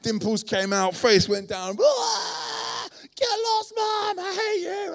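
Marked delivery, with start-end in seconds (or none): 1.8-4.9 high, loud, bratty screaming at mum; mock toddler fury yelling at mum; toddler tantrum. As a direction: throw a full three-year-old tantrum.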